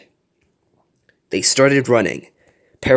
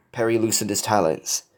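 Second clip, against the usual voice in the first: usual speaking voice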